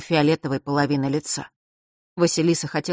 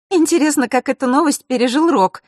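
high, louder, amused remark; smirking; 9 semitones higher, 5 dB louder than usual. A narrator's reading. Diana wonders how Rok took the news, smirking. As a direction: toss it out with a smirk. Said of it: "ухмыльнулась Диана".

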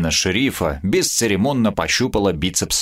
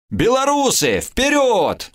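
cheering shout; 13 semitones higher, slower than usual. Acted. Bananza cheers his friend on from the crowd, shouting. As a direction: yell it to spur the fighter on.

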